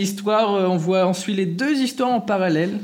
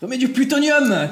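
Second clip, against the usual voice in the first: playful shout; 8 semitones higher, 5 dB louder than usual. Pretend. Joking the host is a machine.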